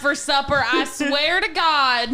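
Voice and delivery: loud hillbilly parent hollering; Appalachian accent. Pretend. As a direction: yell like a hillbilly mom calling supper. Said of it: "like a hillbilly, like, kid's name".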